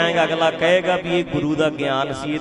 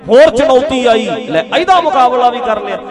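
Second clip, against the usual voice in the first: rousing preaching; 4 dB louder than usual. Real, not acted.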